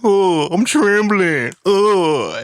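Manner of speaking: high, slow mock trembling; teasing scaredy-cat voice; homie's movie-quote voice. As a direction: drag it out in a fake-frightened falsetto. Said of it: "he would use that voice".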